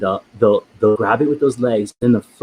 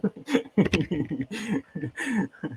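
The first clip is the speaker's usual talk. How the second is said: long, high, soft giggling; helpless high giggles after a fright; nervous giggle; 9 semitones higher, 9 dB quieter than usual. Real, not acted. Host after the creature bolted, nervous giggle.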